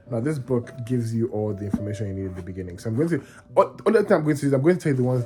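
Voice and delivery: ordinary talk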